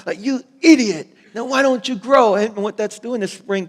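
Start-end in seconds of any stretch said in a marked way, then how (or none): 0.2-2.4 high, loud mock scolding; angry, impatient cursing. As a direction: scold a plant in exasperation. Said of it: "angry and impatient with the plant that you just start cursing it".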